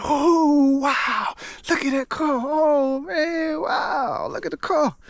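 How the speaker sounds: imitating kids gasping at a new car; amazed girls. Stepdaughters marvel at new car.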